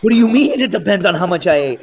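loud, high panicked demand; adrenaline-fuelled alarm. Acted. Poisoned contestant questioning the medic, loud adrenaline-fuelled alarm. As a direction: act out an alarmed outburst at the medic. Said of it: "just adrenaline rush".

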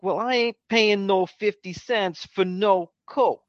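high, slow imitation of a street accent; D'Annunzio's accent. Movie customer refusing the price of a Coke, indignant imitated accent. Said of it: "mocking his accent".

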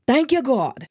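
relieved exclamation. Retold or imitated. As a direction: re-enact a burst of grateful relief. Acting out her tearful relief.

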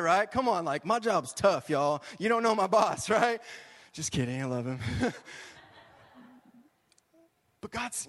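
ordinary talk, nothing marked

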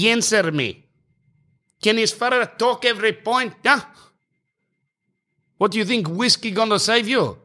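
put-on high, accented incredulous tirade; ranting tennis player. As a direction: imitate a player haranguing the umpire. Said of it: "he sound like he's".

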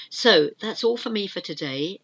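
normal talking voice

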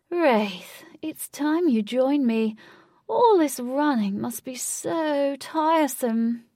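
high, loud, slow drawn-out call; crying out. A narrator's reading. Lutz calling Wraith across the field, slow eerie cry. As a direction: stretch the words to carry far. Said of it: "cried Lutz".

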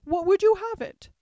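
high, loud matter-of-fact queen; haughty queen. A narrator's reading. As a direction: answer as an imperious queen stating the obvious. Queen questions Alice haughtily.

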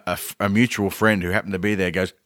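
usual speaking voice

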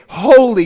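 Holy proclaimed with force; exclaimed holy. Shown as a demonstration. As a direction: Holy hit high and loud like an exclamation. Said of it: "like an exclamation point".

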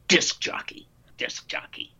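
unvoiced, cutting verdict; dismissive whisper. Voice teacher whispering her verdict on a radio guy. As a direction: whisper the label like an accusation. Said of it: "she looks at me and says".

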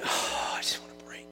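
breathy, fully whispered sigh of longing; acting out a weary inner thought, whispered. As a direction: whisper it like a private wish. Imagining weary disciples' secret thought.

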